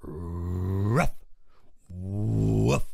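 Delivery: RUFF and WOOF with long, low openings swelling to the end; drawn-out first sound. Shown as a demonstration. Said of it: "really drag out the first syllable".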